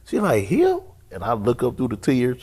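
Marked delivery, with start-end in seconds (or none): 0.4-0.8 high, loud questioning yelp; incredulous. Retold or imitated. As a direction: act out someone's stunned disbelief. Woman's disbelief at new pastor, acted.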